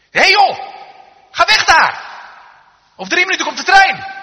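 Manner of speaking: full-force high-pitched yell; urgent warning shout. Acted. Bystander warning a man standing on the tracks, shouting. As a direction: shout as if to save a life.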